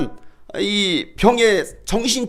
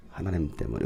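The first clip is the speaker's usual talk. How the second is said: fading blame; 13 dB quieter than usual. Shown as a demonstration. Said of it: "repeated blaming phrase".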